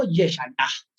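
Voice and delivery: Yeshayahu in Hebrew, ye-sha-ya-hu, said softly; Hebrew pronunciation. Shown as a demonstration. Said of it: "en hebreo". (Yeshayahu dicho en hebreo)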